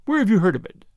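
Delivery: keen, pressing question; sudden interest. A narrator's reading. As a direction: press the priest, suddenly curious. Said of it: "sudden interest".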